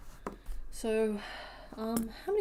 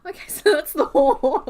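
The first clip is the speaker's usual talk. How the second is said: loud, high words through real laughter; laughing; 8 semitones higher, 13 dB louder than usual. Real, not acted. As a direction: try to carry on while cracking up. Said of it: "I'm sorry for laughing".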